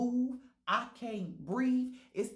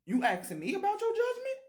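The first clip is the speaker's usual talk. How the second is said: pitched way up in disbelief; a shocked, louder double take; incredulous; 10 semitones higher, 4 dB louder than usual. Real, not acted. To the dying roommate, an incredulous high squeal.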